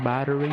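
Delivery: "Battery" as BAT-er-ee; three-syllable "Battery", tried as a question; full-syllable battery. Shown as a demonstration. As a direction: say "Battery" with its middle vowel kept. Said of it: "Pronunciation, man".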